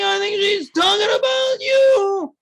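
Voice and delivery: high, drawn-out shouted impression; loud, goofy caricature of an excited friend; funny voice. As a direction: holler it in a silly high voice, stretching each word. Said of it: "trying to do a funny voice".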